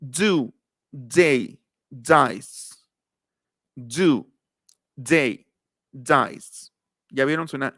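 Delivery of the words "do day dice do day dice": Do, they, dice each starting "dz"; D with a little Z. Shown as a demonstration. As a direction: separate falling words, buzzy initial D. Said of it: "quiero que escuchen esa particularidad".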